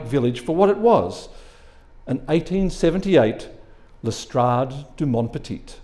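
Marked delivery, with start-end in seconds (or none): none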